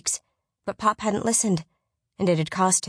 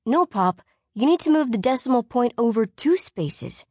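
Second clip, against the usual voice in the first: put-on boy voice; 5 semitones higher than usual. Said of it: "Oliver said".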